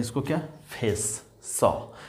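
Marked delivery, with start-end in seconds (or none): 1.4-1.9 isolated "सौ", pitched lower; teacher gives "सौ" as C's sound; sound demo. Shown as a demonstration. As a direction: sound out "सौ" with a clear S. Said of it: "यानी सौ पढ़ते हैं".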